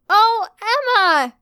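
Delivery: high, loud, drawn-out squeal; excited screech. A narrator's reading. Stella screeches Emma's name as she runs to her. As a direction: squeal it with glee. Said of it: "Stella screeched".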